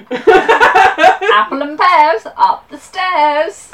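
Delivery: slow, high, loud Cockney with a laugh; drawn-out laughing Cockney accent. Pretend. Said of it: "Cockney rhyming slang".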